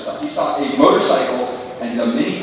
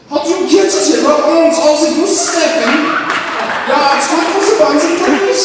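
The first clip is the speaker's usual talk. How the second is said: high, loud, slow fake German rant; mock German speech, high and loud; 12 semitones higher, 7 dB louder, slower than usual. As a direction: bark like a parody dictator.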